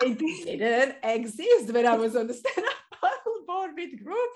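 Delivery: high, laughing disbelief. Real, not acted. Guest recalling the vanished path, laughing. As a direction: laugh through the mishap.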